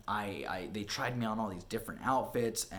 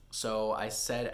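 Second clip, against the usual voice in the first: ordinary delivery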